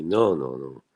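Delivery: ordinary talk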